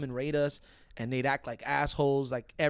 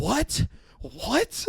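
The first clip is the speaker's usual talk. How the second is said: mom's shocked what; 9 semitones higher than usual. Retold or imitated. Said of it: "mom's like".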